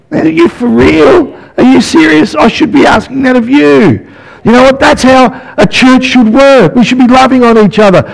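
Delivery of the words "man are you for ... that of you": high, incredulous disbelief; amazed reply. Retold or imitated. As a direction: gush in astonished disbelief. Preacher acts incredulous reply.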